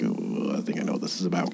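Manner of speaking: soft, breathy worried aside; nervous whisper. Retold or imitated. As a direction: whisper it, caught out. Teen's nervous inner voice.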